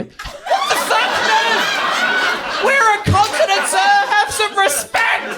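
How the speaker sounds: very high, strained screech; hammed-up indignant meltdown; outraged scream. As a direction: shriek it slowly in wounded national pride. Outburst at slight to Australia.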